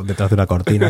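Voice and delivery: ordinary talk, nothing marked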